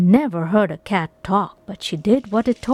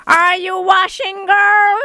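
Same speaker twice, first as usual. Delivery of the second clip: higher, louder cackling hag voice; shrill witch call; 10 semitones higher, 5 dB louder than usual. A narrator's reading. Witch calling through the door again, in a high hag voice.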